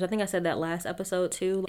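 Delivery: normal talking voice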